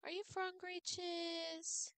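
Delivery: soft high coo to the cat; pet baby talk. Acted. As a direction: coo it quickly like asking a kitten.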